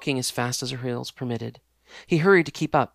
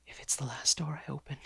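whispered plea; silent prayer; 9 dB quieter than usual. Acted. Exhausted girl prays to the house, whispered. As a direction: breathe it out as a desperate prayer. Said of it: "she prayed silently".

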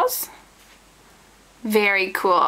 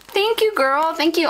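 gushing thanks; 7 semitones higher than usual. Real, not acted. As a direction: thank your friend with a squeal. Thanks friend for the package.

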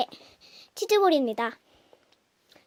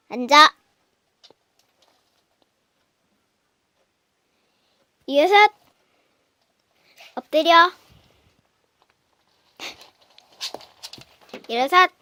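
loud dog commands; 5 semitones higher, 7 dB louder than usual. Real, not acted.

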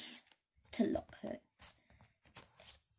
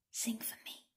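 breathy whisper fading to nothing; 10 dB quieter than usual. Acted. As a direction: plead softly, close to her ear. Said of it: "whispered Hank".

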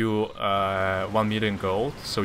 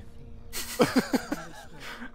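giggles; 6 dB quieter than usual. Real, not acted. Laughing at overpowered spell.

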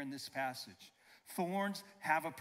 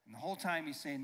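ordinary talk, nothing marked

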